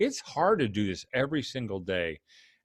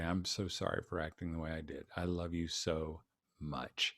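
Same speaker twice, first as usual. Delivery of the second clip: soft bedtime apology; 6 semitones lower, 10 dB quieter than usual. Pretend. Father apologizing to son at bedtime.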